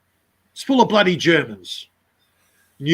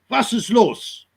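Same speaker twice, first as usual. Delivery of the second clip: harsh German "Was ist los?" with the w as v; German accent; 6 semitones higher, slower than usual. Retold or imitated. Mocking how Germans sound, German accent. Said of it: "German is a strong language".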